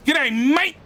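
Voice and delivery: high, loud, hammed-up Australian greeting; mock Australian. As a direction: throw it out like an Aussie bloke. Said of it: "that's Australian for hi".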